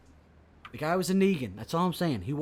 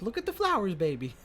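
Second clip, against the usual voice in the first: put-on high, sweet, eerie child voice; creepy little girl; 6 semitones higher than usual. Imitating the girl who went nuts, high and sing-song. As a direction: coo it like a disturbed child. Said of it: "the one was like".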